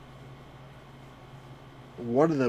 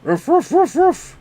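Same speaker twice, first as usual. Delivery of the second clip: louder, rising yappy barks; playful loud barking; dog barking; 12 dB louder, slower than usual. Pretend. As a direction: bark the sheep home.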